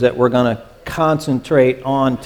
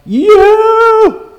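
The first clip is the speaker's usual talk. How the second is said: YOU as a loud, high, drawn-out call; emphatic YOU, pitched way up and sustained; 7 dB louder than usual. Acted. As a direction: cry out "You!" far above normal pitch, holding the vowel. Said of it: "it's emphatic. It's mean he's going".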